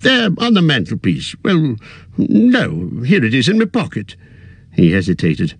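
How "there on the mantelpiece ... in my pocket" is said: higher, lightly voiced elderly hobbit; a fussy old hobbit, a little weary; old hobbit voice, tired at heart; Bilbo. Bilbo's voice in narration.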